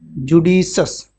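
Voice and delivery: Judicious drawn out slowly; teacher slowly modelling judicious; slow pronunciation demo. Shown as a demonstration. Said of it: "क्या?".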